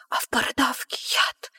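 voiceless creepy whisper; spooky whisper. A narrator's reading. Narrator whispering the poison detail to frighten. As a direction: breathe out the scary secret.

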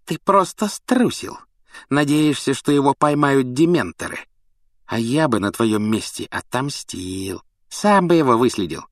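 villainous gloating jeer; snide sneer. Acted. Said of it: "Малфой ехидно засмеялся".

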